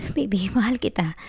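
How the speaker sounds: soft breathy whisper of love. Acted. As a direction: breathe it close to his ear. Tender whisper to partner. (pabulong na malambing)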